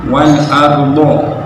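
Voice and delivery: slow wal ardo, open final vowel; correct fathah. Shown as a demonstration. (wal ardo dengan fathah)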